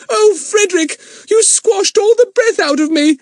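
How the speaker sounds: very high, loud breathless cry. A narrator's reading. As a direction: cry out squashed flat. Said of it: "cried Eunice".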